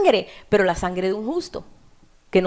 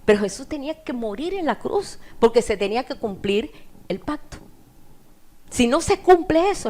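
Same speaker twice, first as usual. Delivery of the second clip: usual speaking voice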